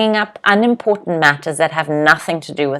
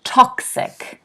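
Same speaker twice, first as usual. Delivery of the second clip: toxic, TOX pitched up and stressed; 6 semitones higher than usual. Shown as a demonstration. Teacher models toxic for students, first syllable stressed. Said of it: "I want you to say this out loud".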